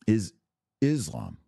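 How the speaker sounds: ordinary talk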